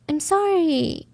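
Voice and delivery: high-pitched sorry falling at the end; rehearsed apology. Retold or imitated. Suspect practising versions of sorry, high and insincere. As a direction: try it high, feigning the emotion. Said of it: "one line in different emotions".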